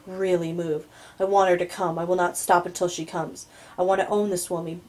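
ordinary delivery

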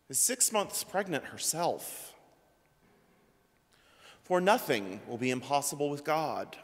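ordinary talk

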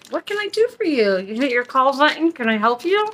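high, sugary customer service voice; fake polite flight attendant. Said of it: "I'll just be like".

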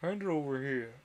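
lightly voiced commanding dodo; dodo character voice. A narrator's reading. Dodo telling Alice to pass the thimble, lightly in character. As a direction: give the order in a hint of dodo voice. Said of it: "said the dodo".

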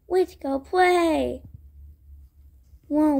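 ordinary delivery, nothing marked

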